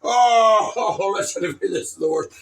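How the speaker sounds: loud, high exclamation; hammed-up distress; mock dismay. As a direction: cry out in dismay. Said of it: "Ray comes".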